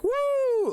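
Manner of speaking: high-pitched "woo"; mimicked high cheer; excited whoop. Shown as a demonstration. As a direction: whoop like one of a cheering crowd. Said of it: "some people are excited".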